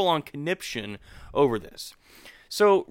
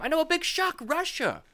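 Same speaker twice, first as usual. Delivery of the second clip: mock shock; 12 semitones higher than usual. Mock surprise at Russia again.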